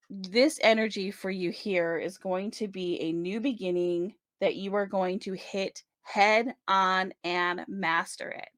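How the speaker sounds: ordinary delivery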